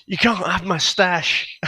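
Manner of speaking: high, drawn-out mock threat; mock drug dealer. Podcaster mimics a film dealer to his co-host, mock dealer voice. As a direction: put on an unconvincing dealer, slow and high. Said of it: "drug dealers don't talk that way".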